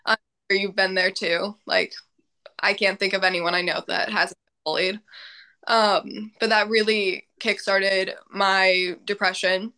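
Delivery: ordinary talk, nothing marked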